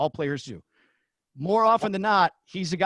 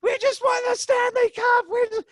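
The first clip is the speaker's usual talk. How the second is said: retold cheering shout; 5 dB louder than usual. Crew on headset celebrating the Cup win, screaming high. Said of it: "everyone's going".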